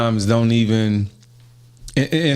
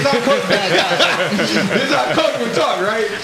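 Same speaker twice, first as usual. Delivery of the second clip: high, laughing through the words; cracking up while talking; giggling high-pitched aside; 9 semitones higher than usual. Real, not acted. Laughing at coach story.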